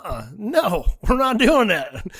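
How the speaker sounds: high, loud refusal breaking into a laugh; laughing while playing the stern dad; retold firm dad.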